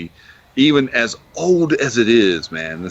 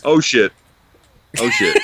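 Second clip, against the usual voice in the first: surprised; 4 semitones higher, 4 dB louder than usual. Real, not acted. Sudden danger in game.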